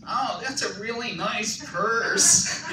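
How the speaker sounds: high, slow, nasal-free put-on little voice through a laugh; George Costanza. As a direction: do a whiny little sitcom-guy voice, very slow. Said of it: "it sounded like George Costanza".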